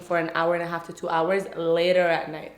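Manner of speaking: usual speaking voice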